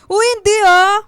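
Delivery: loud, high protest; embarrassed denial. Acted. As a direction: cut him off, flustered and loud. (malakas na pagtanggi, nahihiya)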